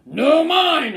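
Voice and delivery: loud hollering squirrel; hollered. Acted. Bruce hollering back at Cyril. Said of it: "hollered Bruce".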